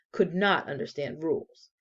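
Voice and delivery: usual speaking voice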